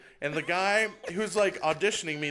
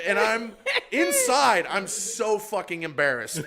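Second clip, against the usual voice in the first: usual speaking voice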